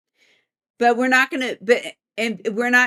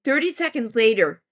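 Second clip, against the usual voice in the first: ordinary talk, nothing marked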